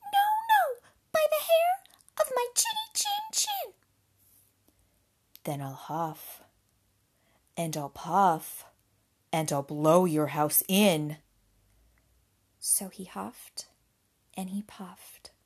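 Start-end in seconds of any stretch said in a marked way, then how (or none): 0.0-3.7 very high, loud squeaky piglet; defiant little pig. A narrator's reading. Pig refuses the wolf entry, high loud squeal. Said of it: "and the little pig". 5.4-11.2 low, loud, slow wolf threat; threatening wolf. A narrator's reading. Wolf threatens the pig's house, low and loud. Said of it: "along came the wolf and said".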